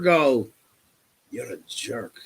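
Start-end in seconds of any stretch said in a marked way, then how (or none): 1.3-2.1 very soft, low grumble; quiet parting jab, retold; muttered insult. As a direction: mutter it under your breath. Muttered insult behind his back.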